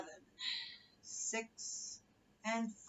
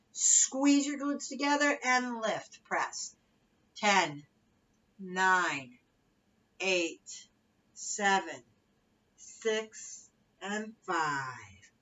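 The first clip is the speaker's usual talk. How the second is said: aerobics instructor; 5 dB louder, much slower than usual. Acted. Fitness cueing and countdown.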